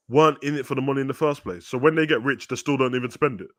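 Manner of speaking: normal talking voice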